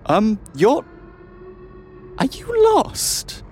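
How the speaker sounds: high, nervous, stammering guard; flustered guard voice. Acted. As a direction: play a flustered young guard.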